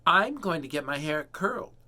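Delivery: a touch of a proud little sister. Acted. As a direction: boast about the curls. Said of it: "said Bertha proudly".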